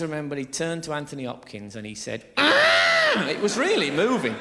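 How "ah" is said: a sudden, very high strangled cry; strangled Elephant Man cry. Retold or imitated. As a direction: squeal it out like a creature who can't speak. Elephant Man's cry acted out.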